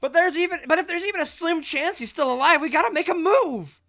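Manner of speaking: loud, high, pleading urgency; frantic loud plea to save a friend; desperate character. Acted. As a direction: push hard to rally the others. Voices desperate game character.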